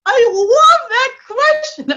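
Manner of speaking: excited gush. Real, not acted. Coach thrilled by the host's question, high and slow. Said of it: "I'm getting too excited".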